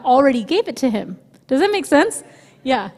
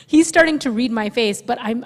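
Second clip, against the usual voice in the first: ordinary talk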